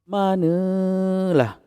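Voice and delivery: high, long-drawn scoff of disbelief; dismissive mother. A narrator's reading. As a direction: stretch it out like a mother who won't believe it. Mother dismisses the boy's ghost cry. (suara emak tidak percaya, meleret tinggi)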